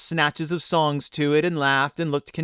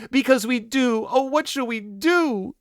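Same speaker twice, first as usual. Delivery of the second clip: put-on high, wailing children's voice; crying out; 11 semitones higher than usual. A narrator's reading. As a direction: wail it high, drawing out the end. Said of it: "they cried together".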